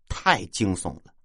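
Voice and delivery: usual speaking voice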